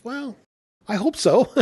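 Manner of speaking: high, playful and falsely modest; coy reply. Retold or imitated. Bartender answering guests' praise, high and coy. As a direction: tease them with mock modesty.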